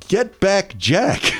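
slow, amused singsong; mock the phrase with a lilt; sarcastic lilt. Real, not acted. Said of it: "you don't have to find everything amusing".

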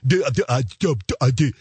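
stuck on d, repeating it loudly; stuttering. Real, not acted. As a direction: stammer hard on the d sound. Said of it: "I'm stuttering".

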